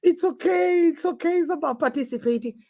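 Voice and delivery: high-pitched mocking sympathy; putting on an over-sympathetic fan; mock consoling. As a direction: coo fake comfort at a beaten champion. Mimics fans consoling the losing manager.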